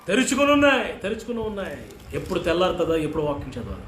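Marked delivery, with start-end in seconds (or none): 0.1-0.9 loud high proclamation; first take proclaimed loudly; emphatic repeat. Acted. Preacher repeats verse phrase twice. 1.0-1.8 quieter echo of తెరుచుకుని ఉన్నాయి; second take hushed; soft echo. Acted. Preacher repeats verse phrase twice.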